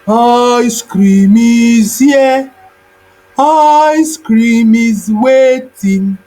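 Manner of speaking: the parents' song, a shade off and slow; beast mimicking song. A narrator's reading. Mimicking beast luring the children with the parents' song. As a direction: sing it like a beast copying the parents. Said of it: "The beast sang in a voice almost like their parents, but with a slight difference".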